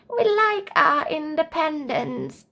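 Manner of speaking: put-on high, slow old lady voice; high slow grandmother; proud grandma. A narrator's reading. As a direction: say it like a dignified granny.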